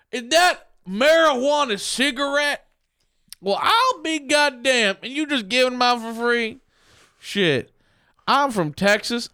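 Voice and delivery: high, slow Texas cowboy drawl; old prospector. Pretend. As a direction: stretch it out like a crusty old cowboy. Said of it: "with a cowboy hat".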